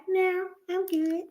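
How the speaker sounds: a lightly voiced small boy declining; a hint of a shy child; Butta's voice. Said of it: "That's just his voice".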